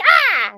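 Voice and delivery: short, loud, high mock "ah!"; startled yelp. Host imitates someone surprised during cheek kissing, startled yelp. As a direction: yelp as if caught mid-kiss by accident.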